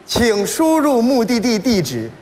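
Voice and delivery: slow, measured sat-nav prompt; navigation voice. Retold or imitated. Standard sat-nav asks driver for destination, measured prompt. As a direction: prompt slowly like a car navigator. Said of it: "北京话导航一开".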